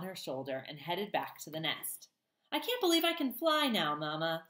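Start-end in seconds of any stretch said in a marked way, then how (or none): 2.5-4.5 high little voice, a hint of sleepiness; a lightly sleepy, wondering gosling. A narrator's reading. Gosling murmurs to his mother on her shoulder, lightly sleepy. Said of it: "in his sleepy voice".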